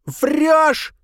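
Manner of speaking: high, loud hateful outburst; hateful cry. A narrator's reading. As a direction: hurl the word with hatred. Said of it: "с ненавистью выдохнула".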